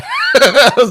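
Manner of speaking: sudden high burst of laughter; loud laugh. Real, not acted. Laughs at own remark.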